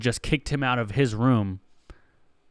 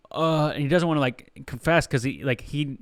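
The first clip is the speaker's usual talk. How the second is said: ordinary talk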